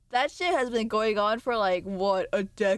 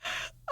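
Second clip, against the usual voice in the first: sharp gasp; 11 dB quieter than usual. Real, not acted. Startled by an image.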